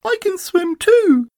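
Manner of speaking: very high, slow boast; proud little Roo. A narrator's reading. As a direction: boast as tiny squeaky Roo. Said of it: "said Roo proudly".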